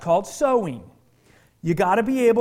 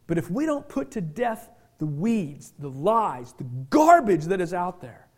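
ordinary talk, nothing marked

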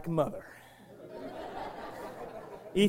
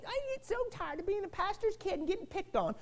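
mock complaining daughter; 5 semitones higher, 11 dB quieter than usual. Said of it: "Don't say".

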